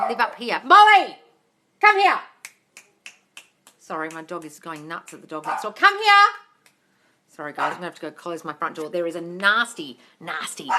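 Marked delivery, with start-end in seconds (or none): 0.6-2.2 loud, high call to the dog; calling out over a barking dog. Real, not acted. 5.7-6.3 loud, high call to the dog; calling out over a barking dog. Real, not acted.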